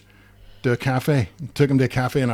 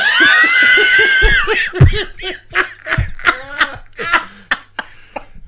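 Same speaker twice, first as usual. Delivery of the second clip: loud high burst of laughter; real hearty laughter, loud and high; big laugh; 7 dB louder than usual. Real, not acted. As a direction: let the laugh ring out. Laughing at own story.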